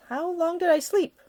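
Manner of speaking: a hint of a sleepy young lady; lightly voiced young woman. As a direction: lift the voice a little for her. Ida voiced by narrator.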